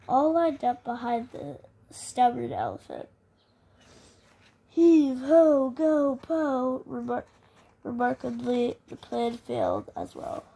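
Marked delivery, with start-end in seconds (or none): 4.7-6.8 loud hearty heave-ho call; group heave shout. Acted. Townspeople shouting while shoving the elephant. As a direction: shout it like pushing hard.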